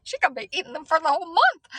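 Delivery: amused disbelief. Real, not acted. Disbelief at mom's order.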